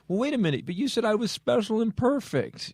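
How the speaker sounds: put-on high, pleading young adult; whiny kid voice. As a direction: whine like a spoiled kid. Voicing the whining grown kid.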